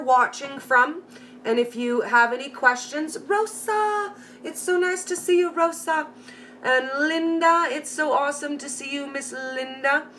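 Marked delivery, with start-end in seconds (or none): 3.2-10.1 sing-song welcome. Real, not acted. Host greets Rosa and Linda in the chat, high and sing-song. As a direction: sing each name like a welcome.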